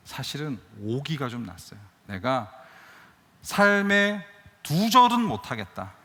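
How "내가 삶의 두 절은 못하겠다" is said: loud, slow, emphatic declaration with stress on 절은; slow, loud and drawn out, landing hard on 절은; defiant vow. Retold or imitated. Stubborn vow after injustice. (절은에 힘주어 크게 천천히 외치는 다짐)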